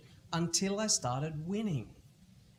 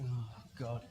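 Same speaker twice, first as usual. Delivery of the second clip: much softer, lower groan; weary groan; 7 semitones lower, 12 dB quieter than usual. Retold or imitated. Son groaning at dad's next demand, soft and defeated. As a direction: sigh it out, defeated. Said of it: "I'm like".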